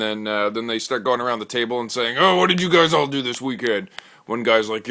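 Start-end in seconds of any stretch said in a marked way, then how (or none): 2.2-3.9 louder, higher hyped bro voice; host asking the guys about their weekend, loud and hyped; macho bro question. Acted. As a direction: ask it like a pumped meathead.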